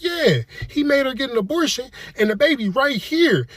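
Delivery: high-pitched indignant outburst; worked-up, high-pitched accusation; indignant exposé. Acted. Exposing boyfriend while showing the urn.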